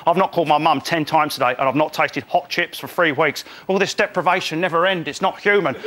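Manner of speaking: a hint of spoilt moaning; mock complaining campmate.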